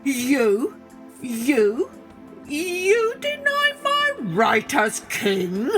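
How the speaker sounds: high, slow, outraged roar of disbelief; a lion sputtering with fury; mock-furious lion voice. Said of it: "worked himself into a perfect fury".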